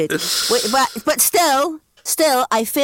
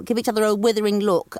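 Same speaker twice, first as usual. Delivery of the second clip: usual speaking voice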